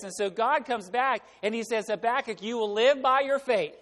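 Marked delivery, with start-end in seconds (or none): 1.9-3.8 raised, higher, emphatic declaration; firm divine answer. Acted. God comes back to answer Habakkuk, firmly emphatic. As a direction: proclaim it as God's command.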